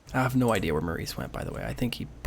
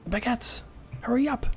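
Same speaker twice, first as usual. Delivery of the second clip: calling out; 12 semitones higher than usual. Real, not acted. Calling companion to hurry.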